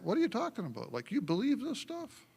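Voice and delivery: high, disbelieving skeptic; mock-incredulous skeptic. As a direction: scoff at it in disbelief.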